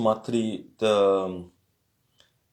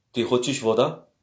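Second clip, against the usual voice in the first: usual speaking voice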